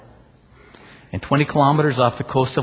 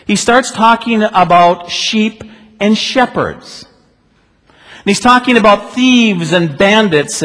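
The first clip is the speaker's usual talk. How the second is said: loud, higher pulpit voice; forceful preaching; 8 semitones higher, 8 dB louder than usual. Acted. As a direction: drive the story home from the pulpit.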